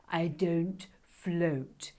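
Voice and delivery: very slow, weighty dragon line; slow solemn dragon. Acted. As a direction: drag it out gravely. Sandra dragon voice.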